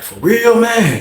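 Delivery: high-pitched, incredulous question; disbelieving. Retold or imitated. Inmate questioning his homeboy's news, high and disbelieving.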